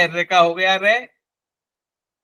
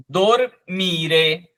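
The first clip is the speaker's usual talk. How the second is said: ordinary delivery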